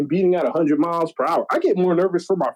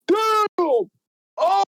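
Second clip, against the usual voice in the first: mock crowd gasp. Imitating crowd reacting to beatdown.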